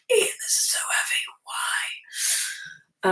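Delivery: a high whispered mock complaint. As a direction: whisper the moan as if crushed by the weight. Retelling trying on heavy arming jack.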